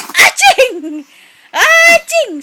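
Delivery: high, loud pretend "aching"; playful mock sneeze. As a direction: exaggerate a fake sneeze to amuse a baby. Playfully imitates a sneeze at the baby. (kunwaring bahing, pabiro at matinis)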